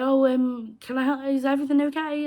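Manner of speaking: a hint of a sweet, careful helper; polite concern.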